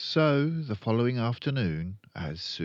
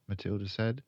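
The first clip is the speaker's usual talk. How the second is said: plain reading voice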